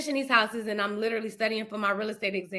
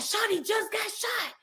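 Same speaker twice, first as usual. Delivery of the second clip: frantic caller; 12 semitones higher, slower than usual. Retold or imitated. Caller reports son was shot.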